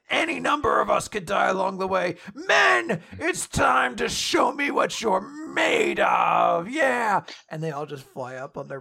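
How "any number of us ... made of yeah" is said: hammed-up battle cry to the troops, shouted and much higher; mock rallying shout. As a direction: parody of a commander's speech, shouting high. Retold rallying speech to men.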